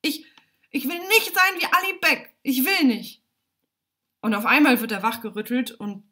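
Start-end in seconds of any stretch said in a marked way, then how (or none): 0.0-3.2 high, loud cry of refusal; boy's desperate high yell; shouting. A narrator's reading. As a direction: stammer once, then shout your protest. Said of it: "schreit Alimjan".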